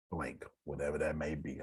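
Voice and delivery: ordinary talk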